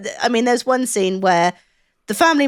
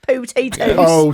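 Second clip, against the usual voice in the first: West Country potatoes; 3 dB louder, much slower than usual. Retold or imitated. Joking about West Country accents.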